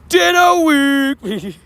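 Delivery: high, long drawn-out hype shout; excited event call. Real, not acted. Streamer hyping the coming event to chat, excited drawn-out call. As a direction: stretch both words out high, like an announcer.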